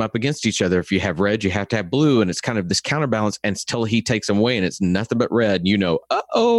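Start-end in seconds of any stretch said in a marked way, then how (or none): 6.1-6.6 mock uh-oh. Acted audience reaction to all red.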